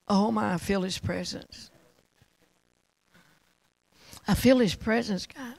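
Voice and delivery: reverent, with long awed pauses; hushed worship prayer. Real, not acted. Preacher invites listeners to honor God, hushed reverent prayer. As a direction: lead the room gently into worship, unhurried and subdued.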